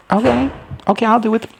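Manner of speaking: high, squeaky little assent; mock timid agreement. Rider agreeing to mount the dragon, mock timid.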